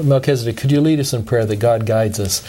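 ordinary talk, nothing marked

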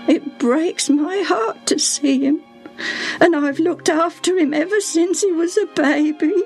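high, distressed servant's lament; acted heartbroken pleading tone; grieving servant. A narrator's reading. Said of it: "Her voice broke down".

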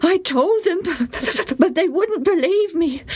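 put-on high, hurt boy's voice; sulky boy. A narrator's reading. Joe complains.

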